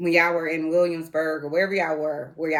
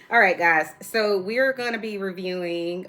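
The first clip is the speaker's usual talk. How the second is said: ordinary talk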